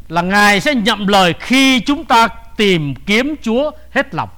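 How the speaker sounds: slow, deliberate preaching delivery; emphatic promise. Real, not acted. Preacher explaining God's promise to listeners, slow and emphatic. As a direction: spell out the promise phrase by phrase.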